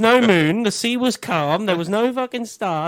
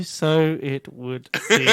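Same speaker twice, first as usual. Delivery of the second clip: slow pompous; 3 semitones lower, much slower than usual. Pretend. Slow mock-wise captain line.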